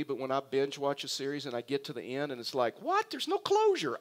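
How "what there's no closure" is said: sudden high-pitched indignant cry; mock outrage at the ending. Pastor playing a cheated binge-watcher, high indignant yelp. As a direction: squeal it like the finale just robbed you. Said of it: "I'm mad".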